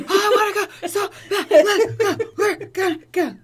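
high, loud, laughing gush; bubbling over with glee, laughing; overexcited. Retold or imitated. Acting her own eager reply.

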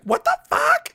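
very high, loud squawk; mock shocked outburst. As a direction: act out a shocked shriek. Acts out character's shocked outburst.

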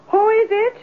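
high, alarmed call through the door; startled call. Acted. Landlady calling out to a knock at her door, alarmed.

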